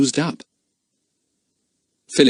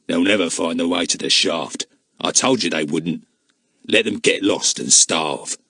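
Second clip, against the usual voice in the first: sour; 6 semitones lower than usual. Acted. Ollie sourly dismissing the lost children. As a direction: lower gruff sour dismissal. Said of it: "said Ollie sourly".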